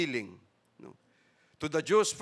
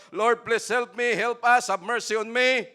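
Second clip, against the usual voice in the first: pleading cry for help; 6 semitones higher, 7 dB louder than usual. Retold or imitated. Woman begging Jesus for mercy, raised plea. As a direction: beg the Lord for help, a bit louder.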